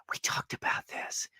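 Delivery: hushed scolding whisper. Retold or imitated. Wife reminding husband, whispered scolding. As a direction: breathe it as a pointed reminder. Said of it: "she's like".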